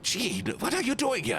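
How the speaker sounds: high, surprised mock question; startled homeowner. Viewer finding an intruder at home, high and startled. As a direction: play the shocked victim woken at night. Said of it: "you're like".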